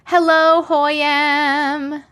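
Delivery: lilting welcome; singsong greeting. Real, not acted. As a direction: hold the name out on a high level note. Greeting a viewer by name.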